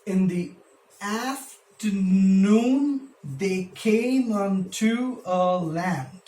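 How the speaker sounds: very slow, AFTERNOON stretched out, pauses between phrases; scanning the meter. Shown as a demonstration. Teacher scanning the next line for students, slow stretched beats. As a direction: draw out each beat of "afternoon, they came unto a land". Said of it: "Now see what is happening here".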